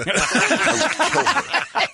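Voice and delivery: high squealing laugh through words. Real, not acted. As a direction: laugh it out slowly. Laughing repeat of threat.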